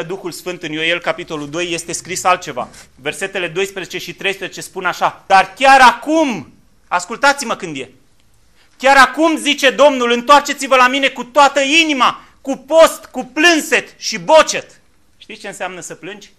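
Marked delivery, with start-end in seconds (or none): 5.3-14.8 loud, high preacher's call; fiery sermon at full volume; full-force preaching. Acted. Reading Joel at full force.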